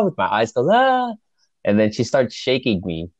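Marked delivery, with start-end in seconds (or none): 0.7-1.1 high-pitched imitation of a scream; re-enacted yell. Sleeper yelling with eyes closed, imitated high-pitched yell. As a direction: mimic yelling with your eyes closed, voice shooting up. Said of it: "So I'm just yelling".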